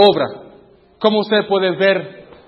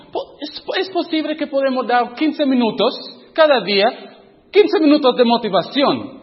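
proposing eagerly in a higher voice; eager proposal; 8 semitones higher than usual. Retold or imitated. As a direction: pitch the idea brightly and high. Pitching an idea to the group. (propuesta entusiasta con voz más aguda)

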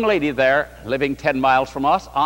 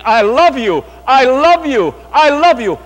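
rising, louder, higher repeated declarations of love; voice of Jesus; 7 semitones higher, 8 dB louder than usual. Retold or imitated. Jesus calling to the new believer, fervent and louder. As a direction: call it out more urgently each time. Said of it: "Jesus was saying".

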